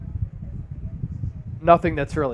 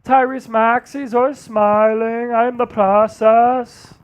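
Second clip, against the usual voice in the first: slow, higher Embiid voice; Joel Embiid impression; 4 semitones higher, slower than usual. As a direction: drag it out like Embiid missing his teammate. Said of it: "that's Joel Embiid".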